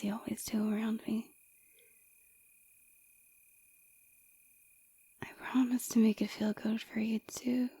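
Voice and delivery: sultry ASMR whisper; seductive whisper. Acted. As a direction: whisper it to draw them in.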